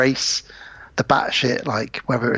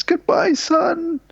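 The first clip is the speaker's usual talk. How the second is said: high, strained farewell; imitating George; much slower than usual. Dad saying goodbye as he shoots his son, high and strained. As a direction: cry it out high, a father's last goodbye. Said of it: "and says".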